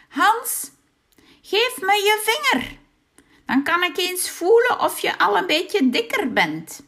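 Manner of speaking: high, loud witch voice; a greedy old witch, high and loud. A narrator's reading. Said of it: "als de heks langskwam en zei". (hoge, luide heksenstem)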